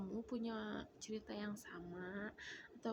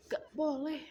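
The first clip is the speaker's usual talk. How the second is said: raised, louder retold "no"; firm forbidding; 4 semitones higher, 9 dB louder than usual. Mother telling a child not to eat it, firm forbidding. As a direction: forbid it sharply and loudly.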